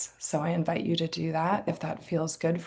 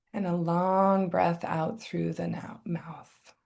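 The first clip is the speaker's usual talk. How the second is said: guided meditation; 5 dB quieter than usual. Acted. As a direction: guide the breath quietly, leaving long silences. Leads a meditation.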